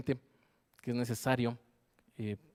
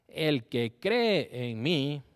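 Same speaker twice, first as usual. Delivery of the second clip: "El que cree en mí" cried out louder and very slowly, stretching "cree"; loud cry; 3 semitones higher, 5 dB louder, much slower than usual. Acted. Preacher acting out Jesus crying out, slow and loud. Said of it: "Con voz fuerte".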